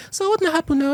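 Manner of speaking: put-on squeaky high boyish voice, way up; tiny high voice. Schoolboy mimicking his old tiny voice, high and thin. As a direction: pitch it up like a small tiny-voiced boy. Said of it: "my voice was, like, tiny".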